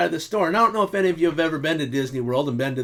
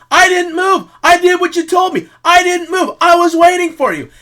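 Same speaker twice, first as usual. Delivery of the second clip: higher, louder protest; defensive shout; 14 semitones higher, 9 dB louder than usual. Retold or imitated. Husband defending himself to his wife who left him waiting, loud protest. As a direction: insist loudly that you followed orders. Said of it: "You're like".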